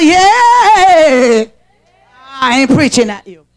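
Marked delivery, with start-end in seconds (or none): 0.0-1.4 loud, ringing yeah; shout yeah. Pretend. Preacher leading the church in a loud shouted yes. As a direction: call it out like the congregation. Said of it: "Somebody shout".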